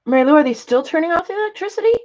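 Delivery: high, fast eager question. Real, not acted. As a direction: rush the question out. Calling to a viewer.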